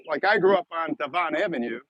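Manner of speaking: ordinary talk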